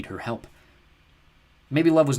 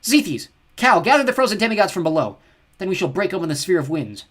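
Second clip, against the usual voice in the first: loud high imperious orders; cold goddess commanding loudly; 8 semitones higher, 9 dB louder than usual. Acted. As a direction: bark orders at her sons. Goddess commanding her sons.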